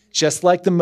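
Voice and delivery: ordinary talk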